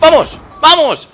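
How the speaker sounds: loud, high cry of victory; real gamer celebration shout; triumphant shout. Real, not acted. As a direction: yell it out after the kill. Celebrating a kill in game.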